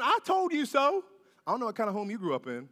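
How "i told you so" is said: mock told-you-so. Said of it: "wagging his finger at God, saying".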